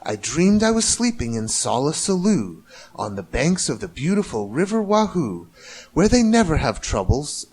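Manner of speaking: ordinary delivery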